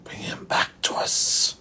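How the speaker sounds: breathy angelic whisper; soft ghostly repeat in a whisper. Retold or imitated. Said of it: "and he says".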